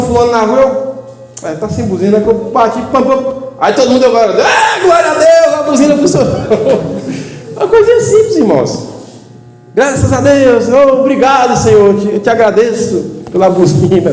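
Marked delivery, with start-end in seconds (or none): 4.4-6.3 joyful shout. Retold or imitated. Family in the car cheers when the horn works, loud joyful shout. 6.4-7.5 short high breathy laugh; burst of laughter. Real, not acted. Preacher laughs at his own horn story, high burst. 7.6-8.4 words squeezed out through laughter, high; laughing. Real, not acted. Preacher still laughing at the horn story, high. 9.7-14.1 mock joyful thanks. Imitated thankful prayer.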